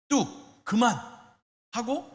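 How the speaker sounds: stern parent. Retold or imitated. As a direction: cut the crying off sharply. Parent stops child's tantrum. (아이를 달래며 단호하게 뚝)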